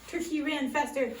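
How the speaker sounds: ordinary delivery, nothing marked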